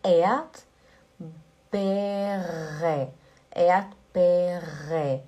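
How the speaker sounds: Erdbeere with a long open A sound where the R was; Erdbeere, Ea-at-beh-reh; stretched vowel. Shown as a demonstration. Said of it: "Primeiro é o A".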